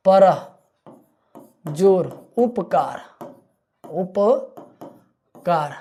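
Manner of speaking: परह जोर उपकार drawn out slowly, उपकार stretched long at the end; slow syllable split. Shown as a demonstration. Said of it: "परोपकार".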